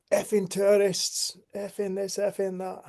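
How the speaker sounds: high-pitched, drawn-out mock grumbling; mock angry rant. Mimics angry driver's rant.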